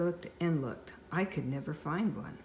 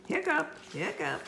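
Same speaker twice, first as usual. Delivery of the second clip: hiccups; 11 semitones higher than usual. Acted. Monster hiccups.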